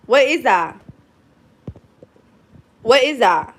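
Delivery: loud, exasperated questioning of a dog; alarmed scolding. Real, not acted. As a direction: demand answers loudly from a misbehaving pet. Alarmed scolding of dog over what it ate.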